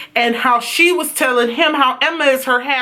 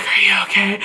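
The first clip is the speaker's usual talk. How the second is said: whisper; 6 semitones lower than usual. Retold or imitated. Kieran whispering to shaken Emma, asking if she's okay. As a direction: breathe it like a secret. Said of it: "And Kieran's like".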